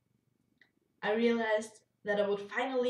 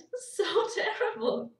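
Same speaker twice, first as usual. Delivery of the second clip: laughing; 10 semitones higher, 6 dB louder than usual. Real, not acted. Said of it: "did you just hear what I said".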